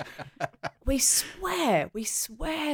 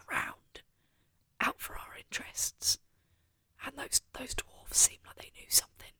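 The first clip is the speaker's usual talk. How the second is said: whispering; 3 semitones higher, 7 dB quieter than usual. Real, not acted. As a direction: murmur it unvoiced. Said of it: "just whisper".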